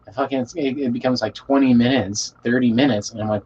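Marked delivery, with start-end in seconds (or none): none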